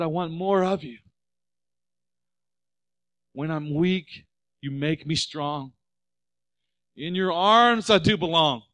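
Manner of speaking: a touch of rising, fervent prayer, slowing down; heartfelt prayer. Retold or imitated. Pastor reciting the prayer he wrote, mildly rising fervor. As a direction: pray the words as they come, building up. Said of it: "I wrote these words out".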